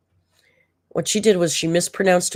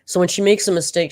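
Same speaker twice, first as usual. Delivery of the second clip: ordinary talk, nothing marked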